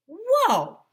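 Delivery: sudden high yelp of surprise; high, loud whoa; startled shout. A narrator's reading. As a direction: yelp as you trip. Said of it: "Mister Cooper shouted".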